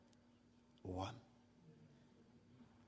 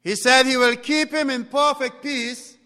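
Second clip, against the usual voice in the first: loud, high pulpit proclamation; full-force preaching; 9 semitones higher, 11 dB louder than usual. Acted. Preacher promises peace to the congregation, preaching hard. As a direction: lift the voice and proclaim it.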